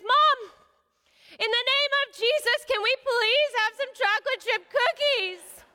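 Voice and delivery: put-on high, loud, slow kid's proclamation; bold child's plea. Daughter invoking Jesus for cookies, loud and high. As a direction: declare it like a little preacher. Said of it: "and so she says".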